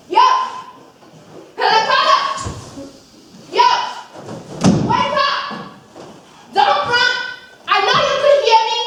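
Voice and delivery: yelling at Judas. Retold or imitated. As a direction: bark him awake, fast at the end.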